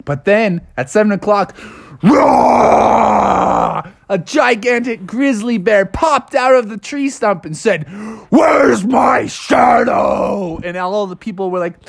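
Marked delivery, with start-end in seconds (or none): 2.0-3.8 loud growling roar; bear roar. A narrator's reading. Bear pops out of stump. 8.3-10.6 very slow, shouted bear voice; grizzly bear voice. Acted. Giant grizzly speaks.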